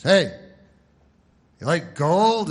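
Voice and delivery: loud, high salesman's come-on; pushy commercial pitchman. Retold or imitated. Comic mocking a TV gold salesman, loud and pushy. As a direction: sell it hard like a TV ad guy.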